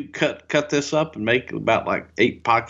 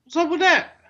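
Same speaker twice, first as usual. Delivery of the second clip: high-pitched mock North Carolina catchphrase; North Carolina accent. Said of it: "North Carolina accent right there".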